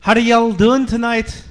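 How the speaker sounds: loud stage greeting. Real, not acted. Singer greets crowd on mic.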